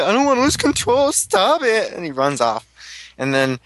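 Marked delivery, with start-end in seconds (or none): 0.0-1.9 much higher, straining mock panic. Fan acting out the hero losing control in a panic. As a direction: squeal it like he's losing control. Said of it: "then he's like".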